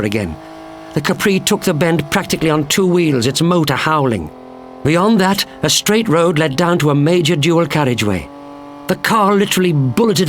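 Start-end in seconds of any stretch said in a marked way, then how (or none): none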